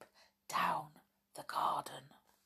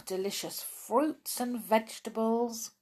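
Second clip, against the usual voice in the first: ordinary delivery, nothing marked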